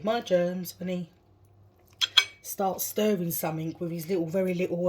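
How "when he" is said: normal talking voice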